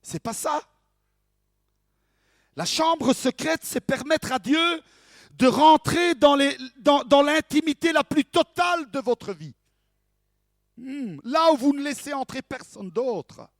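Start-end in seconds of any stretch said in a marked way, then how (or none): 2.5-13.5 raised pitch, loud and forceful; emphatic preaching. Real, not acted. Preacher telling congregation what the secret room means, emphatic preaching. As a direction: proclaim it with full conviction. Preacher drives home the true meaning.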